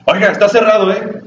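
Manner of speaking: a sharp, high scolding call, retold; angry shout. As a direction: snap it out high like an annoyed museum guard. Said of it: "con una voz así fuerte, y gritado, y enojada".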